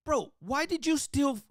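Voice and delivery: high, slow, disbelieving bro voice; incredulous teammate. Retold or imitated. Teammates after losing the game ask a high, slow disbelieving question. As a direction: ask it like you can't believe what he did.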